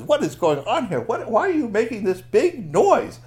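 high, loud storybook scolding; loud, baffled complaint from a story character; indignant Pakwatchi. Acted. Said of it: "Pakwatchi comes in and says".

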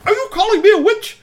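very high, loud outraged screech; hammed-up witchy fury, high and loud; angry. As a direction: shriek it in offence. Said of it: "she got really kind of angry".

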